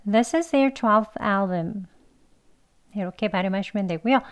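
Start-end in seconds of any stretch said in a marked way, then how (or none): 0.1-1.9 This is their twelfth album run together, is there near a j sound; quick and linked. Shown as a demonstration. Teacher repeating the sentence quickly and linked. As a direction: say it fast and joined. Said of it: "한 번 더요".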